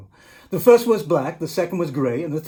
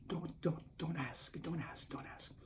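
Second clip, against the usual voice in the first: fading to a whispered don't ask; 20 dB quieter than usual. Real, not acted. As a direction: hush them with a whispered warning. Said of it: "whispered warning".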